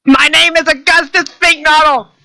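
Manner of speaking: high, put-on character voice; Augustus. Augustus introduces himself to the boatman, high character voice. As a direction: announce yourself in a high voice.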